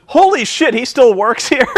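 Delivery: high-pitched amazed outburst; incredulous. Retold or imitated. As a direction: blurt it out in amused disbelief. Acting out own shocked first reaction.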